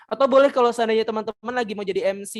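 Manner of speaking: normal talking voice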